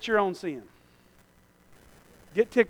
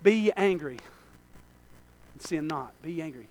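softer, lower "Be ye angry"; quiet musing; 4 semitones lower, 10 dB quieter than usual. Shown as a demonstration. Preacher turning the verse over quietly to himself. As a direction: say it almost to yourself. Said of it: "I can't preach that".

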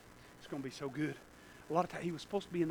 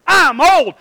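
high, loud complaint; shouted excuse; 9 semitones higher, 17 dB louder, slower than usual. Acted. Old man giving his greatest excuse, shouted complaint. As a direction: bellow the excuse. Excuse set against angel's response.

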